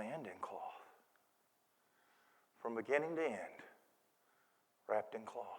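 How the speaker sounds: very soft, low and measured; quiet awe over a newborn; hushed reverence. Acted. Reverent reveal of baby in barn.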